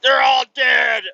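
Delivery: put-on loud, slow, high kid's yell of triumph; excited shout. Dad imitating his son gleefully yelling over the toy fight. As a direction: bellow it like a gleeful little boy.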